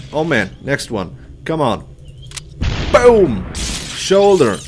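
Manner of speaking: ordinary talk, nothing marked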